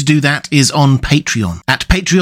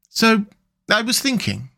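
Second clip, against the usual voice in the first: dreamy and languid; 8 semitones higher than usual. Acted. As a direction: drift off as you speak. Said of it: "dreamful and languid".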